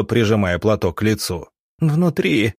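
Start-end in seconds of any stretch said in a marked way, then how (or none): 1.8-2.5 high-pitched anguished moan; high wail without much volume. A narrator's reading. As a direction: moan it out high, like a distraught village woman. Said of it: "простонала она".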